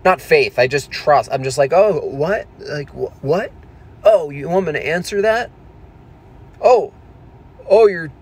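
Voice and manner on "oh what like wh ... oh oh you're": high, loud, wide-eyed surprise; mock gullible astonishment; naive surprise. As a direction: play the trusting innocent taken aback. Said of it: "I'm naive".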